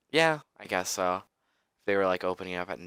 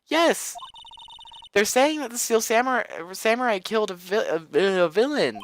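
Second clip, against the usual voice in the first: much higher, louder frantic news; high frantic girl; 13 semitones higher, 4 dB louder than usual. Acted. Maya tells Nick the Samurai was arrested, high panic.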